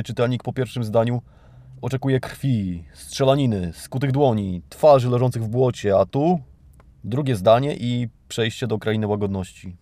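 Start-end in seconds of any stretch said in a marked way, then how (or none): none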